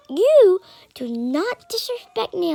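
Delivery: stern retold warning, drawn out; indignant warning. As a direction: stretch out a mock-stern warning, YOU pitched high. Retold argument, boy's indignant warning.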